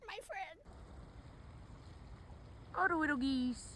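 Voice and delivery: a light, cutesy coo; fond little aside to the geese. Real, not acted. Cooing at geese.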